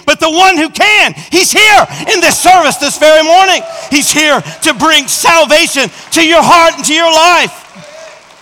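loud, high, full-force altar call; preaching. Acted. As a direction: preach it out to the arena.

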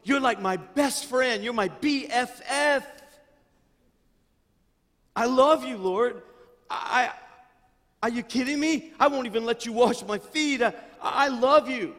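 loud, high, overeager declaration of love; excitedly insisting he loves him; gushing Peter. Acted. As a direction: play Peter gushing to the Lord. Pastor acts out gushing Peter.

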